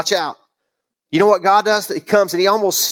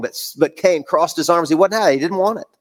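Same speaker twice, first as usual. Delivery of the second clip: ordinary delivery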